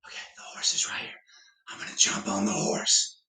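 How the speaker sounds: hushed sneaky whisper. Pretend.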